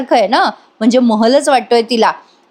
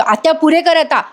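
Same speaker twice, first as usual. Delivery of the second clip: higher-pitched, mildly fed-up retort; annoyed outburst; 6 semitones higher than usual. Retold or imitated. Jiva telling his aunt to stop, annoyed outburst. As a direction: cut the aunt off, lightly acted. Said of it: "लगेच चिडतो बरं का जीवा".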